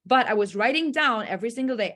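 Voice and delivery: ordinary delivery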